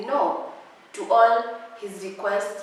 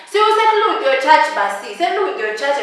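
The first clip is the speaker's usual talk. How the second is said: scolding yell; 6 semitones higher, 7 dB louder than usual. Real, not acted. Scolding, shouting advice.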